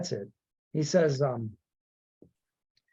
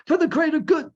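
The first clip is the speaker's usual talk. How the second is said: much higher, louder, breathless and sing-song; imitating Saw; 12 semitones higher, 6 dB louder than usual. Host nails Saw's breathless plaintive line, high. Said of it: "breathlessness to it".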